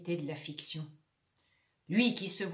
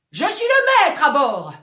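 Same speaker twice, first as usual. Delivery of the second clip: loud, proud declaration; shouting; 6 semitones higher, 14 dB louder than usual. Acted. Lone actor shouting his command. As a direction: shout it like a captain. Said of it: "il crie".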